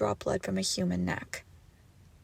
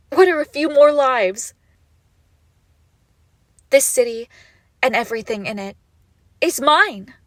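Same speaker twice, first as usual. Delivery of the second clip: louder, proud and possessive; grandiose claim; 4 semitones higher, 10 dB louder than usual. Acted. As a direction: declare the city hers. Megalomaniac declaration.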